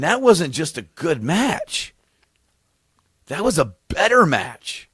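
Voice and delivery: high, slow, swooping emphasis; drawn-out reverent raving; stoned insistence. Retold or imitated. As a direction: insist like an awestruck, very high fan. Stoned fan insisting on greatness.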